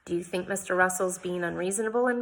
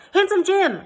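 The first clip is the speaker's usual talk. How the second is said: much higher, loud call; 13 semitones higher, 9 dB louder than usual. A narrator's reading. Jane calls out to Handsome Jim for help. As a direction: call him over from afar.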